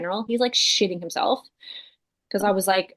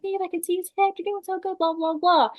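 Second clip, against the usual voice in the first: husband cheering; 10 semitones higher than usual. Retold or imitated. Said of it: "Sam's like".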